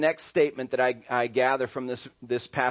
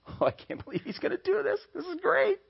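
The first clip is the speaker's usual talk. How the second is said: high, giggly mock kid voice, far above the speaker's own; gleeful troublemaker kid. Preacher voicing pride as the egging-on kid, giddy high mock voice. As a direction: cackle along like the kid egging a friend on.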